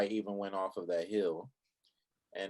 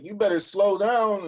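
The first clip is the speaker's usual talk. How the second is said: called-out warning; 10 semitones higher, 7 dB louder than usual. Retold or imitated. Friend warning him on the dune.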